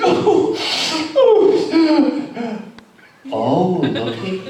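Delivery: high, loud breathless gasps turning to laughter; mock swooning. Mother seeing tall Franz arrive, breathless high swoon. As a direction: swoon over the handsome young man.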